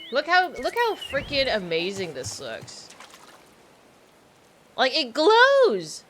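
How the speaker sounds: loud, slow amazed exclaim; excited. Real, not acted. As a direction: gush over how it glows. Awed at glowing item.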